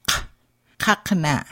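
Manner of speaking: "kakna" with a k deep in the throat; back-throat q. Shown as a demonstration. Teacher modelling the letter Q for learners, deep k. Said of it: "a K deep in the back of your throat".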